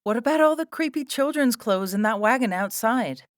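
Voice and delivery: high, loud wail. A narrator's reading. Sarah confronts the circus folk, wailing. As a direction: wail the question at a high pitch. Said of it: "wailed Sarah".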